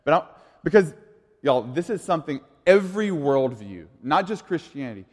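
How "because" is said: ordinary delivery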